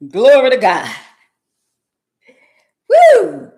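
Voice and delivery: loud praise shout ending in a high whoop. Real, not acted. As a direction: shout glory like in church.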